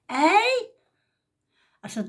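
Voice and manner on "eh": put-on deaf old lady's eh. Deaf old woman answering.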